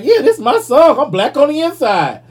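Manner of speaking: high, loud mock of a smug host; mocking Chris Cuomo. Mocking the TV host's boast to the audience. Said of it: "he was like".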